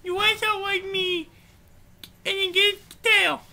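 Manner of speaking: lightly voiced cartoon pig boss; a touch of swagger, piggy character voice; self-important pig. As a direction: hold forth as a pompous puppet pig.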